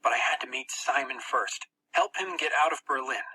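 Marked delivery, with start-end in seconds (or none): none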